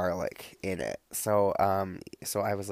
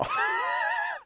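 high squeal laugh; 3 dB louder than usual. Real, not acted. Giddy about falling in love.

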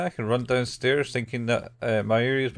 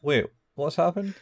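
higher-pitched, puzzled put-on voice; mock confusion; 6 semitones higher than usual. Man on the rooftop senses things are different, mock puzzlement. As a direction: break off, acting lightly bewildered. Acted confusion after retold scream.

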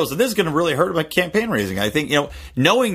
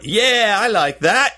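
higher-pitched mock South African accent; put-on South African accent; 7 semitones higher, much slower than usual. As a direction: slip cheekily into a fake South African accent. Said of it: "I thought we weren't gonna do the accents".

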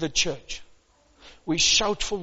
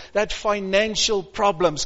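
normal talking voice

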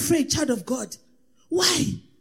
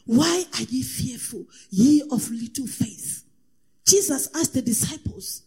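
ordinary delivery

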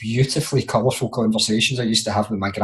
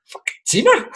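much higher, stunned "Fucking Tina?"; shocked disbelief. Retold or imitated. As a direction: blurt it out in sheer disbelief. His own shocked reaction retold.

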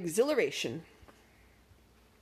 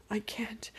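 soft unvoiced whisper; whispered to herself; 6 dB quieter than usual. A narrator's reading. Magic in terror on the toboggan, a frightened whisper. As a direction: whisper it to yourself. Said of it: "directed more to herself".

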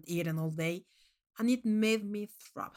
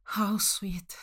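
whisper. Acted. Narrator's inner sarcastic aside.

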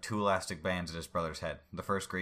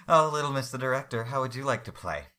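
loud, higher, gloating villain voice; taunting villain; 7 semitones higher, 10 dB louder than usual. Acted. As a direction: toy with him like prey. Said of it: "The Snipper began to laugh loudly".

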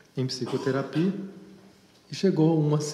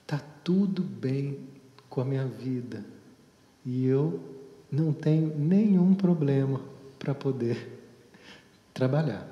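deliberate affirmation. Retold or imitated. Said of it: "eu fiz essa afirmação que ela fez".

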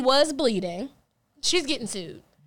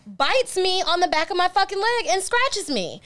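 excited; 8 semitones higher than usual. Real, not acted. Retelling the dog bite.

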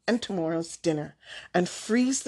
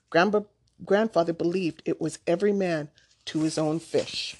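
ordinary delivery

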